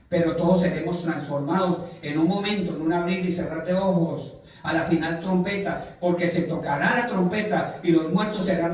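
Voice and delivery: loud, fast preacher's reading of the verse; fervent sermon reading; preaching scripture. Acted. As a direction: proclaim the verse to the congregation. Reads scripture at full force.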